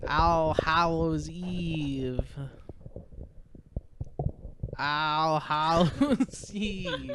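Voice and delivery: slow, spooky Halloween announcement; horror host. Acted. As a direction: draw it out like a ghost story host.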